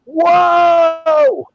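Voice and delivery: high relieved whoa; relieved yelp. Retold or imitated. Relief under shower, yelping.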